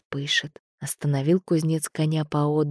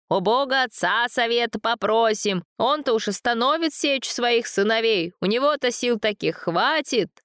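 higher shout over the storm; 7 semitones higher than usual. A narrator's reading. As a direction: call out above the wind. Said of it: "снова закричал старец".

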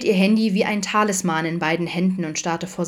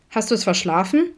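raised, slightly higher incredulous question; reproachful question; 4 semitones higher than usual. A narrator's reading. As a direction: ask it as a pointed dig. Alex greets late Adam, reproachful question.

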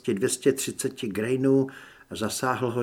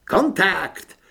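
high, loud shout; sharp one-word combat call; alarmed yell; 6 semitones higher, 6 dB louder than usual. A narrator's reading. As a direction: yell a warning to the others. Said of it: "zaječel někdo z rekreační místnosti".

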